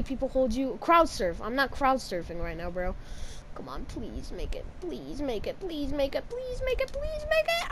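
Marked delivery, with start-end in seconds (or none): 3.5-7.7 pleading, building to a shout. Real, not acted. Gamer begging his character to make the jump, building from soft plea to high shout. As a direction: beg harder each time until you shout.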